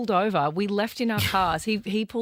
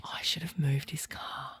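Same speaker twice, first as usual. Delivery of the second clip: whispered aside; 5 semitones lower, 8 dB quieter than usual. Real, not acted. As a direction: breathe it, no voice.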